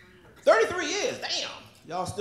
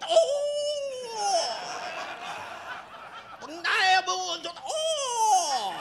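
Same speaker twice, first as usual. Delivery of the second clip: mock moaning; 8 semitones higher, much slower than usual.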